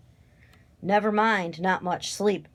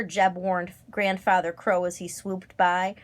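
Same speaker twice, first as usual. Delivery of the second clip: plain reading voice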